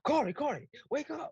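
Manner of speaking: high urgent calling voice; frantic wake-up call. Retold or imitated. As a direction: call out in a panic. Wilmer calling to wake him.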